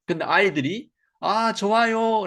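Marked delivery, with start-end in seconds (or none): 1.2-2.3 put-on high, slow eager "좋아요" of children; drawn-out cheerful yes like a class of kids; kids agreeing. Children answering the teacher with a drawn-out yes. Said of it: "라고 얘기했으면".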